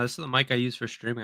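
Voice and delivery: usual speaking voice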